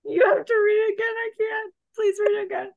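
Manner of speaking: high squeaky laughing plea; helpless giggling; crying with laughter. Real, not acted. Co-host cracking up, begging.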